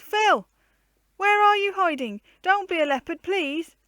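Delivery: high, loud pleading call; calling and begging. A narrator's reading. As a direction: call out, begging him not to pounce. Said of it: "she called".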